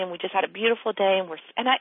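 ordinary talk

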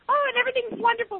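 high, fast sing-song cheer; mocking cheerful friend. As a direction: gush it like all is fine. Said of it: "and then it's like".

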